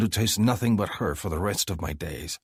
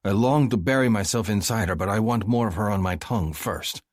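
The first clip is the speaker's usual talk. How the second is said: ordinary delivery, nothing marked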